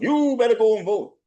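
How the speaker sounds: high put-on scolding voice; mock bossy order. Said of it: "they wanted to force them".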